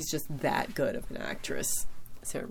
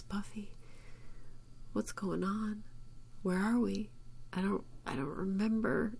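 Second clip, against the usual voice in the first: higher, softer, half-whispered lost voice; a touch of tearful confusion, retold; mild imitation of crying; 6 semitones higher, 7 dB quieter than usual. Re-souled Angel looks up at Buffy, soft tearful whisper. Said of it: "he's crying".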